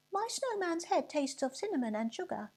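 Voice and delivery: put-on high, eager child's voice; a small boy bursting to tell his mum; excited little boy. A narrator's reading. Boy tells his mother.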